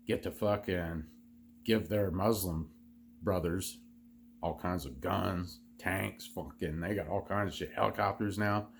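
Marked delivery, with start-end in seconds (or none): none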